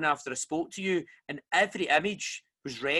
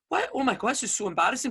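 fast, higher flustered blurt; mock embarrassed shock; 7 semitones higher, faster than usual. Retelling a Reiki session, mock flustered alarm. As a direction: act out the mortified panic. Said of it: "I was like".

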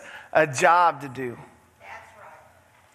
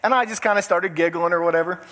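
ordinary delivery

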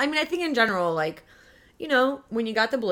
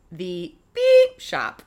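dramatic reveal; 8 dB louder, much slower than usual. Pretend.

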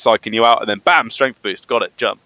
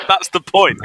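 laughing; 7 semitones higher, slower than usual. Real, not acted. Laughing through date-marry-kill debate.

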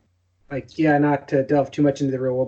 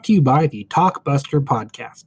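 ad read; 6 dB louder than usual. Acted. Host reads podcast ad.

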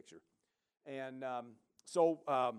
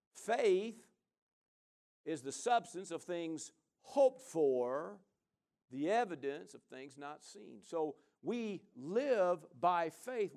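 slow, drawn-out preaching cadence; preacher savoring the verse; 3 semitones higher, 5 dB louder, much slower than usual. Acted. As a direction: lean on each word like a sermon. Pastor preaching scripture slowly.

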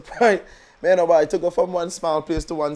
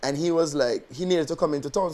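usual speaking voice

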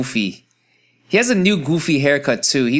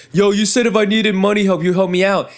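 mock whiny friend; 8 semitones higher, 3 dB louder than usual. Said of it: "getting mad at you".

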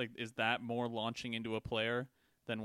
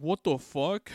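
mock outraged player; 5 semitones higher, 7 dB louder, slower than usual.

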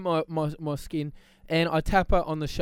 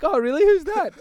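high, bright-voiced curious girl; retold girl's eager reply, voiced high; perky girl's reply; 12 semitones higher, 6 dB louder than usual. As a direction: play the girl suddenly interested, pitched way up. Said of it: "And she's like".

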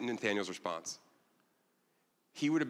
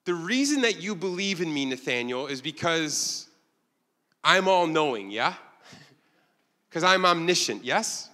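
playful, higher, louder Jesus voice with a laugh; teasing knowing tone, pitched up; voicing Jesus; 5 semitones higher, 4 dB louder than usual. Preacher voicing Jesus to Nathanael, higher and playful. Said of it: "Jesus is saying".

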